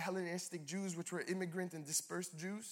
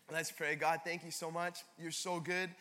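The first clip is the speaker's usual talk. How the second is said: usual speaking voice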